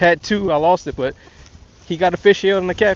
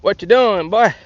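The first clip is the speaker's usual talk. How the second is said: imitating daddy; 4 semitones higher, 7 dB louder, much slower than usual. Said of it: "he'd be like".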